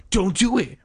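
high, urgent warning; frantic interruption. A narrator's reading. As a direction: cut in with alarm, pitch jumping. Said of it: "Bert interrupted".

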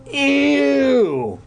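grossed-out squeal; disgusted ew. Acted.

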